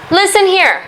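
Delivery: ordinary delivery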